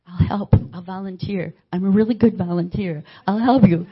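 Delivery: eager pleading. Retold or imitated. Pleading to be let in.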